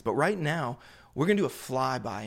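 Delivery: ordinary talk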